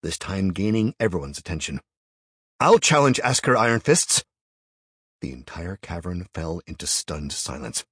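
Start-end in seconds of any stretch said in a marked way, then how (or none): 2.6-4.2 loud, high challenge; shouting. A narrator's reading. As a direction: shout it to the whole cavern. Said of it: "he shouted again".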